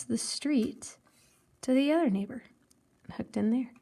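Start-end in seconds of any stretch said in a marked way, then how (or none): none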